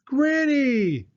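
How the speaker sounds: high, loud cry of delight; joyful shout. Acted. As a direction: shout her name with joy. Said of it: "Anna and Andrew shouted".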